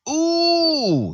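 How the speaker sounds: high, loud impressed ooh; a sharp rising ooh of surprise; surprised ooh. Real, not acted. GM reacts to big hit.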